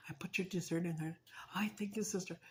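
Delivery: secretive whisper; hushed whispered exchange. Retold or imitated. Secret dessert exchange, unvoiced.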